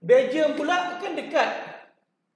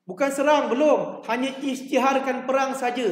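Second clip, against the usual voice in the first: usual speaking voice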